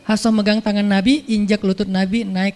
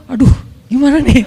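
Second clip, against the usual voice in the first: flustered laugh; 3 semitones higher, 5 dB louder than usual. Acted. Umar flustered, teller laughing.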